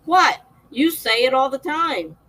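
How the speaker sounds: imitating her own indignant reply; retort. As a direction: snap back at the kids. Mom retorts to her kids.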